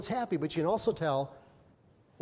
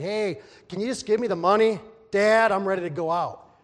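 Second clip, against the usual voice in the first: louder, pushy request; demanding son; 4 semitones higher, 9 dB louder than usual. Acted. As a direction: demand the money, eager to leave. Younger son demanding the money.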